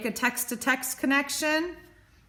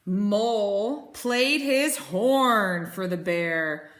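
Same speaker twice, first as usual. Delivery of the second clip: plain reading voice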